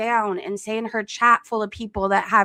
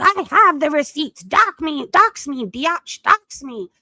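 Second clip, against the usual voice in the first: higher, louder imitation of a rant; mock-angry, raised-voice outburst; mock telling-off; 4 semitones higher, 5 dB louder than usual. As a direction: imitate someone going off on you. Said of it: "telling me off and going off on me".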